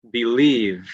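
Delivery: "believe" ending on a clear, buzzed v; emphasised final V. Shown as a demonstration. Teacher giving an ending V example, clear final v. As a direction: finish "believe" fully on the v. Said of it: "using it at the end of a word".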